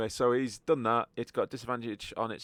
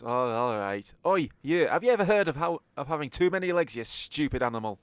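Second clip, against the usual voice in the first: loud, higher-pitched heckling; hammed-up jeering taunt; vicious mockery; 4 semitones higher, 6 dB louder than usual. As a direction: hurl an insult at a dumb beast. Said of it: "vicious mockery at it".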